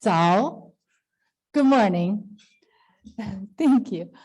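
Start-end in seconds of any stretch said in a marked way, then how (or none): none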